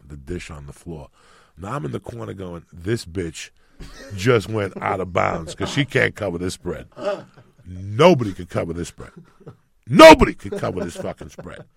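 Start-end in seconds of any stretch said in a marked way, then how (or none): 2.7-11.6 rising from plain to a full yell; building shout. Retold or imitated. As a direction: build panic into a shout, louder each line. Kid panicking, building shout.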